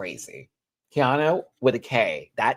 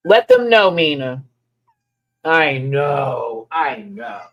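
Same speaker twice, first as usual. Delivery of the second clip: loud cheer for cat; 2 semitones higher, 9 dB louder, slower than usual. Real, not acted. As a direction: hype the cat up loudly. Cat meowing loudly.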